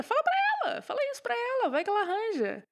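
sarcastic. Acted. Sarcastic high-pitched taunt.

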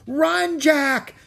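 high, alarmed yell; shouting. A narrator's reading. Annie to Jack as the pirates land, alarmed shout. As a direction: scream a warning to flee. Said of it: "shouted Annie".

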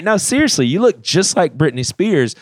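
loud, high mocking jeer at the worship leader; asshole tone. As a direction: taunt her for the crowd. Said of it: "he's got an asshole tone".

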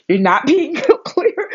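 high, loud, slow laughing reproach; loud high laugh through the words; laughing scold. Real, not acted. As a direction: tease the bird while cracking up. Scolding hawk while laughing.

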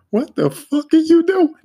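high, loud exasperated shout; annoyed yell. Retold or imitated. Coworker turning around to the narrator, high loud annoyed yell. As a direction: bark it like he's baffled and irritated. Coworker snaps back, quoted.

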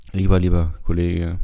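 normal talking voice

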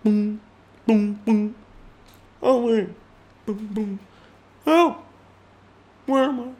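tiny high mime voice; pretend mime. Mime who can't talk asking for help, tiny high voice. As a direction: squeak it out as a would-be silent mime. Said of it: "imagine that I can't talk".

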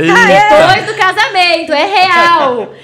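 slow, loud, high laughing confession. Real, not acted. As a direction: laugh while admitting it. (confissão rindo alto)

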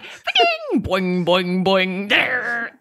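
boing like a dial-up fax; fax machine impression. 'Cause it's like.

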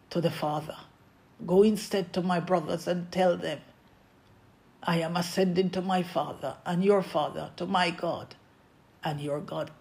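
Jesus softly sending Mary to the brothers, quiet. Acted. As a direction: speak it hushed, as a gentle farewell. Jesus gently sends Mary.

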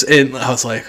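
ordinary talk, nothing marked

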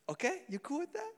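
very high, playful check-in. Real, not acted. Pastor asking baby if he's cool with it, baby talk.

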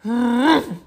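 loud, high cartoon grumpy "hmph"; hammed-up huff of annoyance; grumpy harrumph. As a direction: harrumph like a cross little panda. Grumpy panda harrumphs in story.

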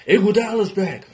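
lightly mock hyped announcement; a hint of a proud team announcer. Mocking Golden State announcing a player's return, lightly hyped. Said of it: "remember Golden State announcing".

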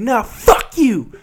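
high, drawn-out mock defiance. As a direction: imitate a defiant passenger, slow and high.

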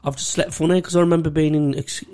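ordinary talk, nothing marked

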